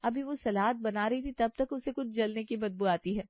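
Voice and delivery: ordinary delivery, nothing marked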